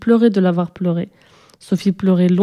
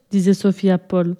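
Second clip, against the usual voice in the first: plain reading voice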